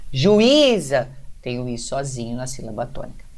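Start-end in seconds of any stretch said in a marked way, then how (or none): 0.1-1.1 juíza with a drawn-out, raised Í; stress on lone Í. Shown as a demonstration. Teacher showing the I alone in its syllable, stressed Í. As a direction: stretch the middle of juíza, ju-ÍÍ-za. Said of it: "uma diferença de pronúncia".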